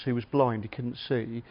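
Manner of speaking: usual speaking voice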